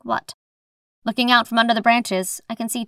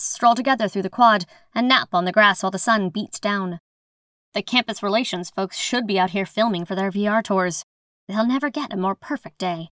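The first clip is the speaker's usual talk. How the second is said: usual speaking voice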